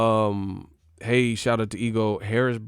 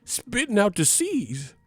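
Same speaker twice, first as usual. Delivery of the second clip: put-on higher old-timey folk storyteller voice; mock storyteller voice; 8 semitones higher than usual. Streamer spinning a tale about a rival, hammed-up storyteller voice. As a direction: spin it like a hammed-up plantation tale.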